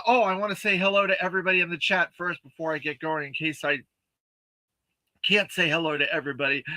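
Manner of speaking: ordinary delivery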